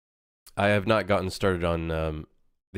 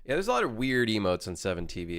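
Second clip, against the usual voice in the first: usual speaking voice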